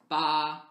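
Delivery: "ba" held high and level; first tone. Shown as a demonstration. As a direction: say "ba" in a loud, level Mandarin first tone. Reads out a first-tone syllable.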